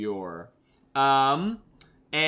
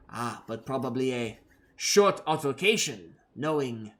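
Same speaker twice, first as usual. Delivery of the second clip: put-on German accent; 2 semitones lower than usual. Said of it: "I can't find my German now".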